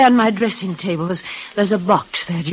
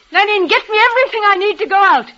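high, fast, eager orders; breathless with happy plans; excited; 11 semitones higher, faster than usual. Acted. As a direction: rush the maid, giddy with joy.

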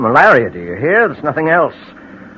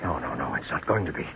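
hushed dread; 15 dB quieter, faster than usual. Acted. Man denies confession in dread.

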